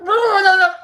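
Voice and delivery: high, loud laughing protest; mock panic, laughing through it. Acting the panicked scientist.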